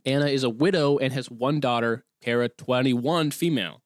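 ordinary talk, nothing marked

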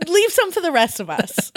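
high-pitched, hammed-up complaint; mock indignant protest. Bandmate grumbling about Roy's two songs, mock indignant. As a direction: play-act protesting in a high voice. Acting a fellow singer's protest.

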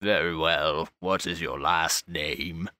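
low, soft, slow and patient; stern archbishop. Acted. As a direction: ask again with cold patience. Archbishop continues questioning.

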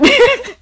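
high, loud short burst of laughter; a sudden squeal of laughter. Real, not acted. Laughs at cheating.

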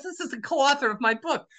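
high-pitched, eager announcement; excited retold introduction. Co-author introduced to the woman, excited.

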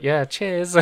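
high, casual cheers; little chef thanks. Acted. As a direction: toss off a pleased thanks. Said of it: "and he's like".

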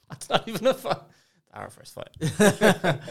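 high, loud laughing protest. Real, not acted. Friend mocked about a fight with his partner, laughing as he answers. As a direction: laugh through the words.

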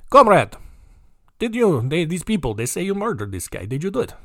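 clueless Russian cop asking a suspect; Russian police accent. Acted. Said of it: "about Russian police is they are pretty surface level".

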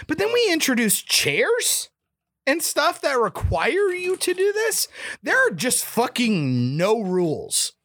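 exasperated rant. Real, not acted. Ranting about movie rules.

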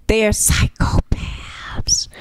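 slow hushed whisper; whispered aside. Real, not acted. Host confiding about bad students, slow whisper. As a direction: whisper it slowly like a secret.

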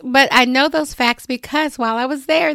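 ordinary talk

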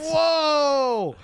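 very high, loud laughing shout; excited whoa. Real, not acted. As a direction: whoop it out in delight. Hosts reacting to a joke.

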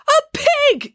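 very high, loud cry of disbelief; incredulous squawk; shocked exclamation. A narrator's reading. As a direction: exclaim it in outraged astonishment. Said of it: "exclaimed the doctor".

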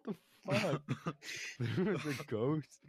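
high, loud incredulous outburst, breaking into laughter; laughing disbelief. Real, not acted. Co-host reacting to a ghost excuse, incredulous and laughing. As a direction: question it loudly, cracking up in disbelief. Real reaction to odd interruption.

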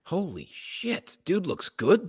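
ordinary delivery

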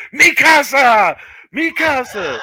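high, drawn-out mock yell; imitated yelling. Co-host imitating a bowler yelling out on the show, high mock yell. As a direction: stretch it out like a bowler's high victory cry. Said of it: "he was yelling out".

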